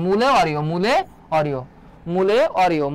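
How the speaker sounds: ordinary delivery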